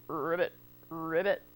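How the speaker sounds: low, soft croaking ribbit; deep quiet frog voice. Acted.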